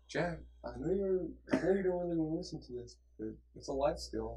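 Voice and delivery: soft imitation of a soothing, monotone teacher. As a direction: coax gently, like a soothing teacher. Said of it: "he has the most soothing voice".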